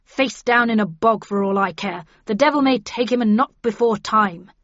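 loud, contemptuous curse; indignant gentleman damning his rival; angry declaiming. A narrator's reading. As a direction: keep declaiming angrily, loudly. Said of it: "declaimed Linton angrily".

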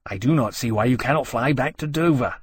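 mildly put-out dragon voice; ungracious. A narrator's reading. Dragon wants the newcomer gone, ungracious. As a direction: grumble ungraciously at the intruder. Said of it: "Temeraire said ungraciously".

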